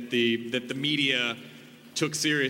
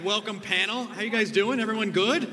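ordinary talk

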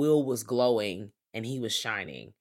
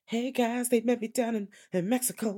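high breezy greeting; 8 semitones higher, 4 dB quieter than usual. Retold or imitated. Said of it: "she slides into the room like".

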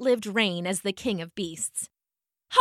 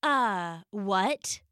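confused; 4 semitones lower, 6 dB quieter than usual. Acted. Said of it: "said the tiger".

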